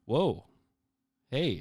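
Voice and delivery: a startled whoa from the doctor; surprised pediatrician. Retold or imitated. Doctor surprised by the healthy girl, startled whoa. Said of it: "the pediatrician ... was like".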